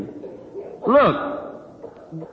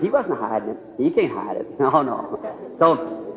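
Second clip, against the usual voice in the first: ordinary talk, nothing marked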